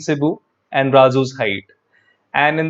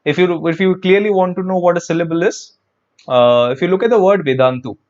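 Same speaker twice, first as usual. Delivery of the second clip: ordinary delivery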